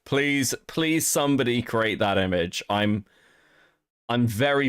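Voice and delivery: ordinary delivery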